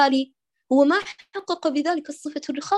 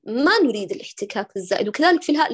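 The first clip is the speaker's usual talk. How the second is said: ordinary talk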